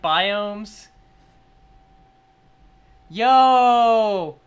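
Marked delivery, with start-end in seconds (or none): 3.1-4.4 long, loud awed yo; amazed exclamation. Real, not acted. Fan amazed by the trailer, loud exclamation. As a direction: belt out one drawn-out exclamation.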